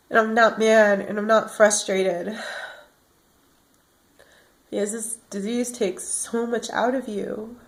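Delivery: fading, worn-out murmur; exhausted. Real, not acted. Telling viewers how the disease wears her out, exhausted. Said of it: "I get exhausted".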